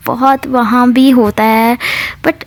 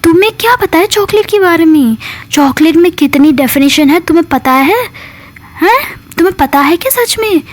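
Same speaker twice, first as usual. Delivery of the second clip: higher, pointed questioning; scornful challenge; 6 semitones higher than usual. Retold or imitated. Kavi scolds Mitra about the chocolate, higher scornful challenge. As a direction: cut her down with mocking questions. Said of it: "ऐसे बोलती है".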